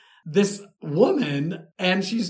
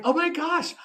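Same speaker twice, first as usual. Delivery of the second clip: high, awed gasp of recognition; retold freaking out; 12 semitones higher than usual. Fan sees famous Hollywood portraits, high retold freak-out. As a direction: jump up in pitch, reliving the thrill. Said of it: "and I'm freaking out".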